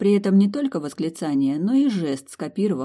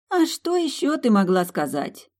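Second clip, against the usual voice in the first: higher, puzzled comeback; puzzled retort; 7 semitones higher than usual. A narrator's reading. Friend puzzled at Milly's question, a puzzled retort. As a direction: answer as if the question is obvious. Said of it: "недоуменно парировала подруга".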